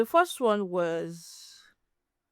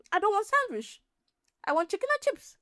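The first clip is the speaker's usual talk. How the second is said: much higher whiny mock beggar voice; demanding beggar imitation; 10 semitones higher than usual. Vlogger mocks a beggar refusing a sandwich, high whiny demand. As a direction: whine for chicken and chips like a spoiled kid, pitched way up. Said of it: "They'll say".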